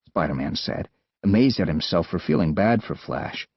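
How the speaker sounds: ordinary delivery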